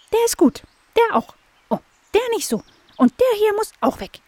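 very high chirpy blackbird; high bird voice. Acted. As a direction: squeak it like a tiny bird. Blackbird inspects branches.

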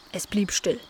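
ordinary delivery, nothing marked

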